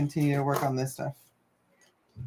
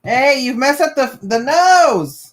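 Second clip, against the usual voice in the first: sharp loud complaint, raised high; 7 semitones higher, 10 dB louder, slower than usual. Real, not acted. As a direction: yell it out in alarm. Yelling at friend over mask.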